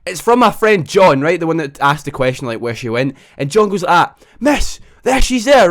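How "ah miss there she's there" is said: high, loud startled call to the teacher; a classmate suddenly spotting her outside; excited shriek. Retold or imitated. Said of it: "we just hear this shriek".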